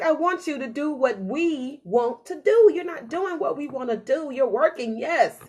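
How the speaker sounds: slow and building to loud high scolding; heart nagging. Retold or imitated. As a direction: lay into the brain, harder each line. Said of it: "my heart is constantly like".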